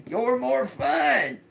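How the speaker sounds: high-pitched squeal through laughter; laughing. Real, not acted. Cracks up, repeats the message.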